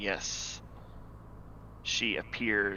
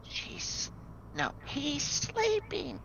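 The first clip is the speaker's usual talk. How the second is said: ghostly whisper; 3 dB quieter, much slower than usual. Acted. GM voices ghost, self-corrects.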